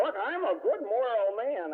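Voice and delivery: slow, high-pitched mock protest; defensive father. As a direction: imitate a father insisting on his own virtue. Father defends himself against accusation.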